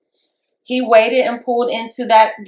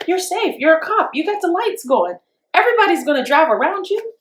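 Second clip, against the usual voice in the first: high-pitched, incredulous protest; retold protest; 7 semitones higher than usual. Driver telling cops they are safe, high-pitched protest.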